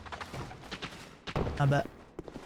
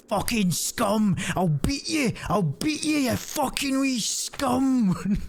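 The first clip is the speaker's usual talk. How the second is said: higher, slow ranting yell; mock dad yelling; 8 semitones higher, slower than usual. Dad yelling at the kid outside, mock rage. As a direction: rant like a cartoon angry dad.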